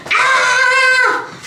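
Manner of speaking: high panicked shriek; startled scream. Retold or imitated. Scream as the bird flies toward her again.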